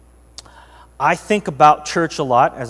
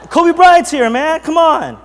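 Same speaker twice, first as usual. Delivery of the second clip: mock-excited, much higher and louder; gushing over a celebrity, pitched high and loud; excited outburst; 13 semitones higher, 9 dB louder, slower than usual. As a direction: burst out like a starstruck fan. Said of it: "excited outburst".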